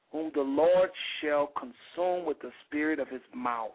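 usual speaking voice

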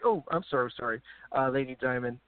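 normal talking voice

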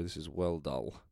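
ordinary delivery

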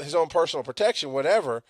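ordinary talk, nothing marked

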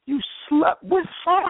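high, mock-tearful girlfriend voice; imitating a crying girlfriend; tearful accusation. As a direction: play the hurt girlfriend confronting him. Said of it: "She was crying".

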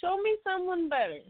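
high, strained mock voice; silly high voice. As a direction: squeak it out as a joke. Teasing with a silly voice.

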